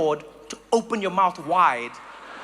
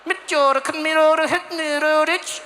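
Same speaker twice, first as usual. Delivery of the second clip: higher, tight-mouthed fake Swedish gibberish; mock Swedish; 6 semitones higher than usual. Swede who can't mess around in winter, curt mock Swedish. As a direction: chatter curt mock Swedish through a barely open mouth. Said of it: "You just gotta get 'em tucked".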